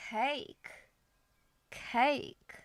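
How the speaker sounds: "Cake" slowly, with a gliding a; Southern vowel in cake. Shown as a demonstration. Student repeating after coach, Southern "Cake". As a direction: copy a Southern drawled "Cake". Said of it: "It'd be cake".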